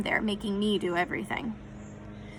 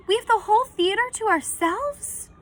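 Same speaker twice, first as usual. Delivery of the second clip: high, loud and thrilled; can't believe his luck, voice jumping up; excited disbelief; 12 semitones higher, 4 dB louder than usual. A narrator's reading. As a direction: boyish excited gasp of a question. Connor thrilled at private screening.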